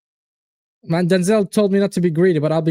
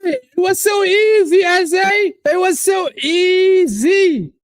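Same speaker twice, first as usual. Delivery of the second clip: celebration shout; 9 semitones higher than usual. Real, not acted. Fan crowing at the easy goal, shouting. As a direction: crow it out to the room.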